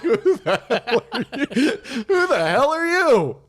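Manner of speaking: put-on high, loud, bewildered old lady; confused grandma voice. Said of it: "She's like".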